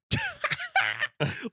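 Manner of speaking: a high-pitched burst of laughter. Real, not acted. Bursts out laughing retelling story.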